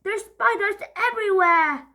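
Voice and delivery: high, loud panic; panicked. Acted. Polly panicking at the spiders. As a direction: cry out in alarm.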